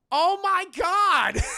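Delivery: high, loud, drawn-out exclamation; acted big revelation yell; mock shocked shout. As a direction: cry it out in hammed-up horror. Both characters voiced, shocked realisation.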